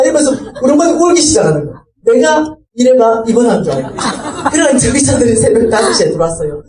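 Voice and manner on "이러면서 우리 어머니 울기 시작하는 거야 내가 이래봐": ordinary talk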